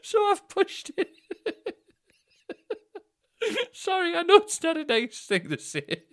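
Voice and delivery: real helpless laughter through the words; squeezing words out between big laughs; laughing hard. Real, not acted. Laughing at own story.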